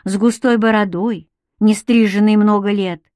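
plain reading voice

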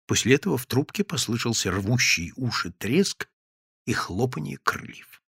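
ordinary delivery, nothing marked

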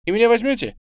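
put-on high, loud, hopeful child; eager exclamation. A narrator's reading. Small boy asking Tamara to take him along, eager and high. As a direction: plead excitedly. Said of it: "воскликнул он".

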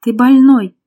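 loud, fearful accusation; frightened. A narrator's reading. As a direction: blurt it out in fright. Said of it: "оцепенев от страха ... проговорила Лера".

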